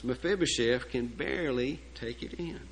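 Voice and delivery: ordinary delivery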